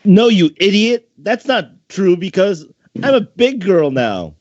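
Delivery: high-pitched catgirl huffing at her brother; pouting little sister. Acted. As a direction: protest like an upset ten-year-old. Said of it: "even if she was a little upset".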